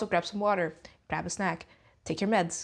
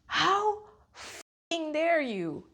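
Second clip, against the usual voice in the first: slow, drawn-out furious disbelief; stunned outrage; 6 semitones higher, much slower than usual. Real, not acted. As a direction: stretch out every word in outrage. Angry disbelief at band statement.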